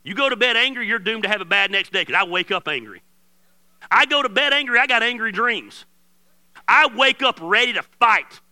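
loud, high preacher's build about anger; fiery preaching. Acted. Pastor preaching to church about going to bed angry, loud and fiery. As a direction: preach it hard to the congregation.

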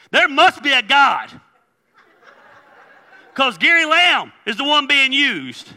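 high, loud declaration; emphatic proclamation. Acted. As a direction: proclaim it loudly to the room. Imitating amazed onlookers, preaching at force.

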